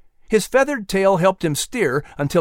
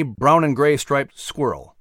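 plain reading voice

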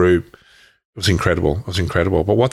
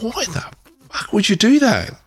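much higher, hurt and outraged; retold hurt outburst. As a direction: fire the question back, wounded. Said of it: "I lost my shit".

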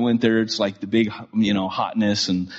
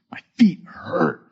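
whispered, slow and weary; a whining whisper of aching feet; tired whisper; 6 semitones higher, slower than usual. Retold or imitated. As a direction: complain under your breath. Worn out at the skit.

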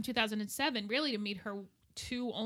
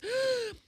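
sharp offended gasp, high; mock gasp; 7 dB louder than usual. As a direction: gasp like a scandalized mother. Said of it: "She goes".

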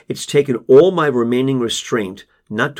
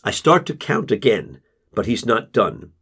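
plain reading voice

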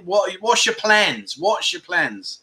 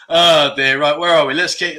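ordinary delivery

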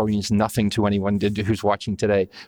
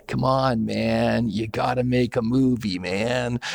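lightly imitated stoner drawl; a loose, easygoing hint of Tommy Chong; mild stoner drawl impression; 2 semitones higher, slower than usual. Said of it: "his standard kind of drawl going".

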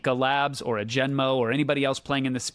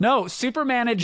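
urgent protest; 9 semitones higher, 3 dB louder than usual. Real, not acted. Cutting in to correct sponsor.

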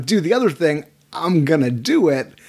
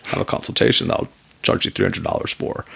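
cagey lawyer voice; 3 semitones lower than usual. Retold or imitated. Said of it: "we're all very cagey".

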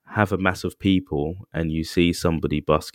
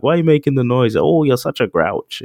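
higher, louder, teasing scold; mock nagging friend; 5 semitones higher, 5 dB louder than usual. Imitating a friend who calls him a grouch, in a louder, higher teasing nag. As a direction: needle him like an annoyed buddy.

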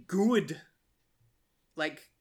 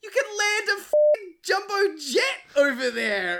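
much higher, louder put-on voice, slowed; mock exasperated quote; 12 semitones higher, 7 dB louder than usual. Host quoting the exasperated reply, very high and loud. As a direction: stretch it out in disbelief.

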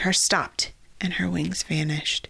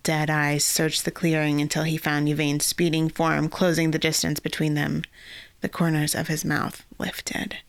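ordinary delivery, nothing marked

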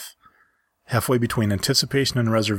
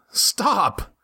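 scream; 11 semitones higher than usual. Acted. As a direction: yell it to make them stop. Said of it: "I screamed".

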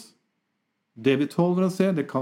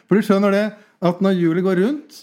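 emphatic explaining; 5 semitones higher, 5 dB louder than usual. Acted. Explaining excitedly.

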